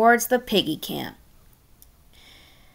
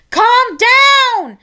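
high, loud commanding shout; Red shouting to calm the green bird; 12 semitones higher, 7 dB louder than usual. Acted. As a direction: yell at him to calm down. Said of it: "Red shouted".

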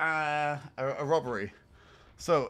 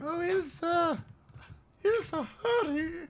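high put-on lady calling out; mock high-pitched woman; female voice; 8 semitones higher, 4 dB quieter, slower than usual. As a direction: call a friend in a high falsetto, drawn out. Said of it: "let's put on some female voice".

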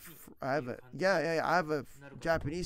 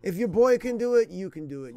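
mock pep talk; 4 semitones higher, 9 dB louder than usual. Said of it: "'cause I was like".

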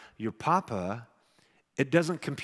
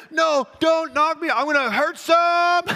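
much higher, louder and slow, hammed-up alarm; mock panicked plea; 9 dB louder, slower than usual. Dad buried under a hundred kids, begging in hammed-up panic. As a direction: plead with the mob in playful mock panic. Kids pile onto him.

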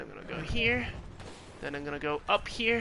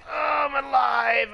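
surprised shout; 7 semitones higher, 8 dB louder than usual. Real, not acted. Sudden survival shout.

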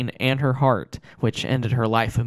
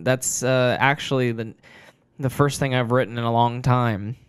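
plain reading voice

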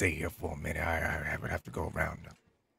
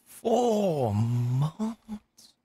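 surprised; 9 semitones higher, 4 dB louder than usual. Real, not acted. Shocked reaction to starving.

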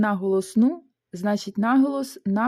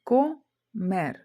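"Комер" broken into two separate syllables. Shown as a demonstration. Teacher dividing the word for learners, syllable by syllable. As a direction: split "Комер" with a clear pause inside. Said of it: "Поділимо це слово на склади".

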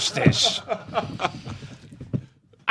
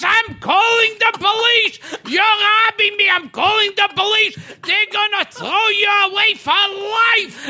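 high, hammed-up threatening rant; outraged shouting; 8 semitones higher than usual. Old boss threatening the model he caught, in outraged shouts. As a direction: yell threats in mock outrage.

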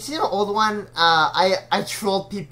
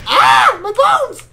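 hammed-up pain; 11 dB louder, much slower than usual. Hammed pain when hit.

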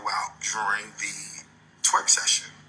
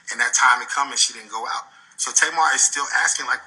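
ordinary talk

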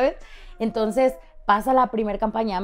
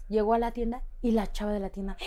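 ordinary talk, nothing marked

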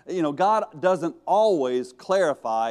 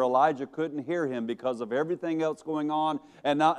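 ordinary talk, nothing marked

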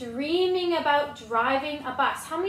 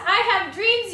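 dramatic pigeon; 6 semitones higher, 8 dB louder than usual. Acted.

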